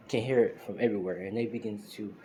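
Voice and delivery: usual speaking voice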